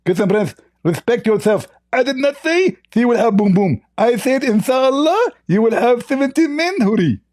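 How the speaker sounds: indignant lisping voice, pitched very high; Zakir Naik. Acted. Mimicked opponent denying what he said, indignant high lisp. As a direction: protest in a squeaky, lisping mock voice. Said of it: "you are Zakir Naik".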